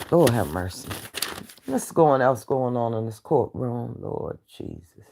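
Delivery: low, soft, breathy plea to heaven; exasperated aside. Real, not acted. Host sighing to God about her stream drama, low and hushed. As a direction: mutter it to the Lord, worn out.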